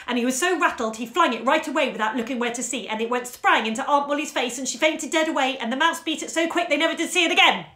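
fast, loud, all in one breath; express train speed. Acted. Storyteller finishing the story to the children in one breath, rushing and loud. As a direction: race to the end without a pause. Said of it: "speeding up to express train speed".

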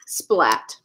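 ordinary delivery

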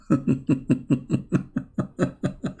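long, breathy chuckle at the absurdity; wheezy chuckle. Real, not acted. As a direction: chuckle helplessly under the breath. Amused at the cover-up phrase.

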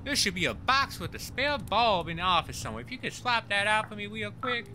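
high-pitched Texan character voice; Texan accent. Acted. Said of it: "you gotta go full Texan".

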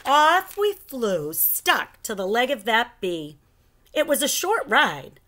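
plain reading voice

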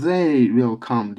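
slow They will come, buzzing th in they; they with th sound. Shown as a demonstration. Teacher models the joined sounds for students, slow voiced th in They. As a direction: draw it out, They starting with voiced th. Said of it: "The, they birleşti".

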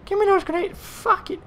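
strained high squeal of annoyance; high-pitched frustration. Real, not acted. Player demanding grenades after a bad death, squealed frustration. Tail of the screamed rage.

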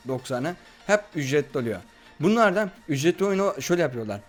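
ordinary talk

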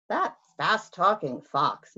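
ordinary delivery, nothing marked

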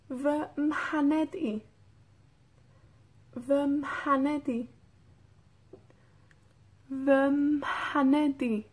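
"Vemheni" said slowly with a breathy mh; slow model for repeating. Shown as a demonstration. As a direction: stretch out each syllable for learners to copy. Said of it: "Escuchen cómo se, cómo suena". (lento y claro para que repitan: Vemheni)

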